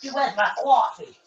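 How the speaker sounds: a badly faked East Coast accent, lightly done; horrible East Coast accent. Friend mocks his own bad East Coast accent, badly faked. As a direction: ham up a clumsy New York coffee order. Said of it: "You do a horrible East Coast accent".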